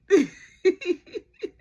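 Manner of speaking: a loud, high rising laugh; high laughing fit. Real, not acted. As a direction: lose it laughing. Cracking up at own story.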